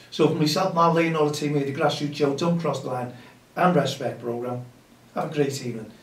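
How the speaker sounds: loud, upbeat radio host voice; radio sign-off. Acted. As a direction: wrap up the show like a presenter.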